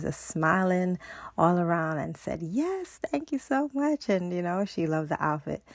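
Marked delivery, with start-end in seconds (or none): 2.4-4.1 grateful lady. Retold or imitated. Delighted customer thanks Raven.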